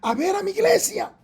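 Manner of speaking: high, loud, drawn-out rallying cry; commanding call. Acted. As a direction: call out slowly like a leader summoning everyone. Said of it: "con voz de mando. Quizás dice".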